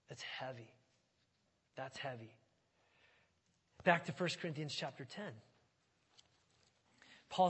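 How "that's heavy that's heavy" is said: much softer, lower reflection; hushed and solemn. Real, not acted. Preacher reflects on the dead, softly and solemnly. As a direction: let the weight sink in quietly.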